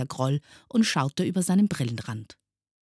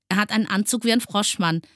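high, fast, loud pupil's call; 8 semitones higher, 5 dB louder, faster than usual. A narrator's reading. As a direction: blurt it out across the classroom. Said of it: "rief ein dritter". (aufgeregt gerufen)

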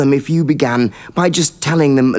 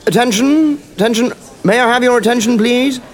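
over the intercom; 9 semitones higher than usual. Acted. Headmaster calling for attention.

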